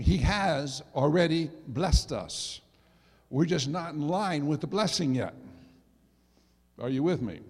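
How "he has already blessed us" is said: "has" stretched out and punched, slow phrase; stress on HAS. Shown as a demonstration. Preacher corrects the church's asking, "has" heavily emphasized. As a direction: hit "has" hard, then space out the rest. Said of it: "emphasize the word there".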